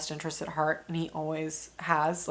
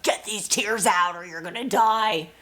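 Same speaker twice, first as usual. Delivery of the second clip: frantic inner voice; 3 semitones higher, 5 dB louder than usual. Retold or imitated. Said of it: "the emotional side is like".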